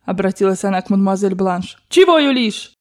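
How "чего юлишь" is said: high-pitched irritated challenge; angry shouting. Acted. Grandmother snapping at the fawning Frenchwoman, high angry cry. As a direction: challenge her sharply. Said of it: "Бабушка неистово кричала на них".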